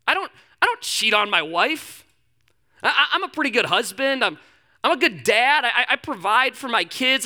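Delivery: voicing a friend. Retelling a friend defending himself on the phone, mildly defensive. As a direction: plead your case as a decent guy. Friend's self-justifying case, voiced high.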